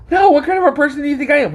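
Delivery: high, quick offended outburst; mock offense, pitched high; indignant protest. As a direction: rattle it off like you're insulted. Friend indignant retelling.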